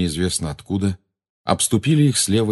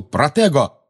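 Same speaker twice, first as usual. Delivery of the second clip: shout; 7 semitones higher, 4 dB louder, slower than usual. A narrator's reading. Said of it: "Он крикнул".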